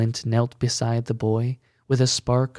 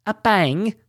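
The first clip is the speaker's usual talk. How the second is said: higher, louder outraged shout; 9 semitones higher, 5 dB louder than usual. A narrator's reading. Woman in shawl scolding Bertrand, shouting. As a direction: fling his words back at him. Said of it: "shouted a woman".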